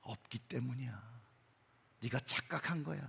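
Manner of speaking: low, hushed, voiced murmur of the tempter; insinuating whisper. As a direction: plant doubt softly, as Satan would. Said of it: "그리고 속삭이죠". (낮게 속삭이는 사단의 목소리)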